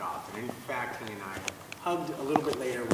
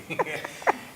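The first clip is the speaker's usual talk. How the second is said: laughing; 6 semitones higher, 6 dB louder than usual. Real, not acted. Laughing at his own silliness.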